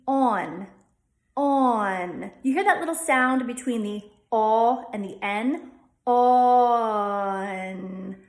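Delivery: "on" with a small schwa after the aw; "on" said so it rhymes with dawn; Delco on. Shown as a demonstration. Teacher shows "on" the Delco way. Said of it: "add a little schwa after that aw".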